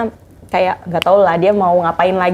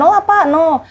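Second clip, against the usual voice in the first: indignant no; 9 semitones higher than usual. Retold or imitated. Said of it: "aku bilang kayak gitu kan".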